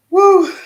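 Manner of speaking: a high, loud celebratory whoop; joyful shriek of glee. Real, not acted. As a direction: let out a real delighted woo. Cheering out loud on stream.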